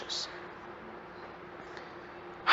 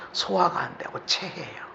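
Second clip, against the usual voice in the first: usual speaking voice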